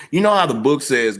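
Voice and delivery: ordinary delivery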